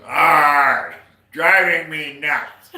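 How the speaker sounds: loud, slow pirate growl. Acted. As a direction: ham up the pirate. Pirate punchline.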